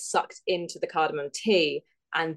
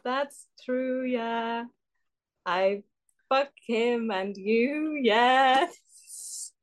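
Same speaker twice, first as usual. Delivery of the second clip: higher-pitched botched Welsh lilt; bad Welsh accent; 5 semitones higher, much slower than usual. Contestant pushing on with the monologue, bad Welsh accent. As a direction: Welsh attempt that drifts into Indian. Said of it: "cannot not sound Indian doing this".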